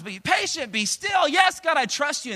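high, loud back and forth; mock exchange. God and believers, loud high mock exchange. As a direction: shout both sides up high.

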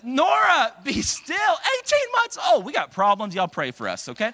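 high, loud exasperated call; exasperated parent. Retold or imitated. Dad to toddler and the crowd, high loud exasperated. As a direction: shout it up high, fed up.

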